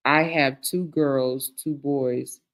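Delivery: usual speaking voice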